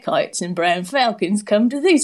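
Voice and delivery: put-on shaky grip on an Australian accent; attempted Australian accent. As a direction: read it aloud in mock Australian. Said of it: "I'm gonna do it".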